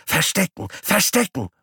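very high squealing call; squeaky guinea pig call. Acted. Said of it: "ruft Paule".

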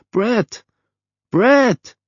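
very high screech, rising in force; screeching calls. A narrator's reading. Mother screeching repeatedly for her son, mounting shrill calls. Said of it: "kreischte eine Stimme".

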